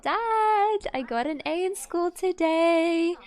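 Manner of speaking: high, bright-eyed bragging to Dad, slowing down on the news; proud daughter bragging. Retold or imitated. Teen daughter brags to her dad about her grades, in a high proud voice. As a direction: show off the A to your dad. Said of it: "She's like".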